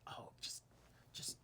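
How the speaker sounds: hushed, sheepish whisper of a guilty wish; secret whisper. Real, not acted.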